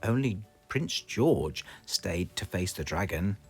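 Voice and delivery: ordinary delivery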